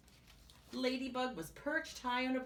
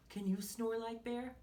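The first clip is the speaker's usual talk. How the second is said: ordinary delivery, nothing marked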